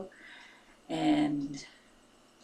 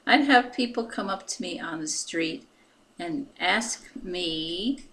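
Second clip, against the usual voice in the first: normal talking voice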